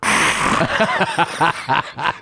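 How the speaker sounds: loud, high open-mouthed "ah"; imitated gasp. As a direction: mimic her gasping reaction, high and loud. Imitates woman's reaction.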